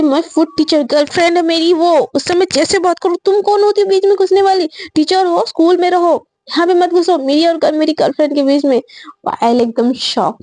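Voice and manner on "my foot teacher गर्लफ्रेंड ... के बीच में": high, angry outburst at the teacher; telling the teacher off, voice climbing; गुस्से में. Retold or imitated. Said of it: "साक्षी ने एकदम गुस्से में बोलती". (गुस्से में ऊँची आवाज़ में डाँटना)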